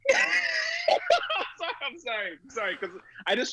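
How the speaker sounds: high squeaky laughter breaking the words; can barely get it out for laughing; cracking up mid-quote; laughing through words. Real, not acted. Laughing while retelling angry man.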